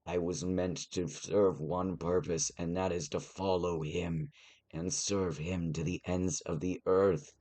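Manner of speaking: usual speaking voice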